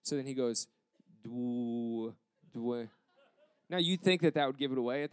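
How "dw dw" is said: "Dw-" stretched slowly on its opening sound; feed "Dw-" as a prompt, holding it unfinished; drawn-out hint. Retold or imitated. Interviewer mouths a drawn-out hint.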